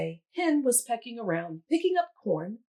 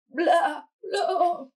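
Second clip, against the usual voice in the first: high, loud bleating; goat bleat; 8 semitones higher, 6 dB louder than usual. A narrator's reading. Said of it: "bleated the Goat".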